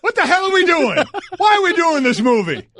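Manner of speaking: high mock shout of cut; director yelling cut. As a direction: yell it like a fed-up director. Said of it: "he had to go".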